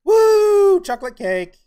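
high, loud celebratory whoop; gleeful high-pitched outburst; excited cheer. Real, not acted. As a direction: cheer with delight at a treat. Celebrating a chocolate cake reward.

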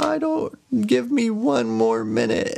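very high, frantic mock plea; acted high-pitched begging; pleading wife. Wife begs for time.

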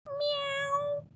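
high soft meow, far from her own voice; a small meowing sound; puppy meow. A narrator's reading. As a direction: mew like a tiny cat. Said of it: "George went".

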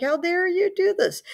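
mock outraged, pitched high; teacher voicing an offended president; imitating angry Adams. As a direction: play Adams, indignant and half-serious. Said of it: "Adams was angry at the French actions".

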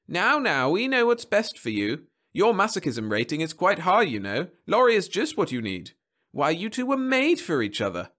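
high, swooping, humouring tone; genial marriage counsellor, soothing. Acted. Counsellor reassuring doubtful husband about his marriage, soothing. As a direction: talk the client round in high lilting phrases.

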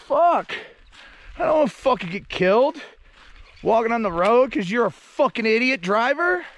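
usual speaking voice

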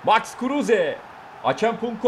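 loud, high shout of the names; hyped goal-call shout; excited goal call. Real, not acted. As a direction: call out the scorers like a commentator. Calling the goal live.

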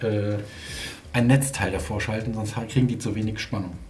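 normal talking voice

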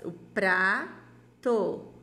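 "o prato" stretched very slow, each sound held long; drawn-out reading. Shown as a demonstration. Teacher reads the first word to the class, very drawn-out. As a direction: read "o prato" slowly, like modelling for children. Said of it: "Juntando as sílabas".

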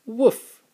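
one high, loud yappy woof; playful puppy woof; dog bark. Acted. Dad barks for the dog.

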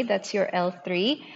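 ordinary delivery

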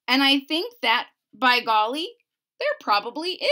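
lively, bouncy read-aloud of rhymes; storybook narration. A narrator's reading. Reading a rhyming book to a child, lively narration.

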